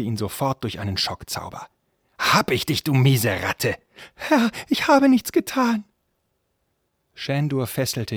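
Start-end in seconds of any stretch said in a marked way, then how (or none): 2.2-3.8 loud, raised-pitch triumphant snarl; angry captor. Acted. Captor seizes the fleeing villain. 4.1-5.8 very high, slow, loud plea; frightened servant. Acted. Caught servant protests his innocence.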